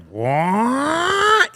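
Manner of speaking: a high startled what; mock shock. Left behind by the convoy, a high startled what. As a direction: react as if you just saw the convoy leave. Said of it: "next thing I was like".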